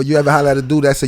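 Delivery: ordinary talk, nothing marked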